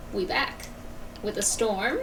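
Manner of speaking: usual speaking voice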